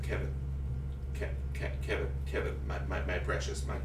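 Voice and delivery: low, hushed, halting plea; pleading film crew. Acted. Film crew to Kevin about reshoots, hushed halting plea. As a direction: stammer it softly and low, like coaxing. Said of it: "And they just went".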